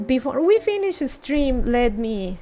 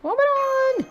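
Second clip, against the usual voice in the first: calling out; 13 semitones higher than usual. Acted. Character calling for Oberon, much higher pitched. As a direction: call the name Oberon far higher.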